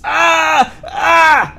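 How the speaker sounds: two piercing high screams; high shrieks of agony; screaming. Acted. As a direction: shriek in sudden pain. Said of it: "He screams".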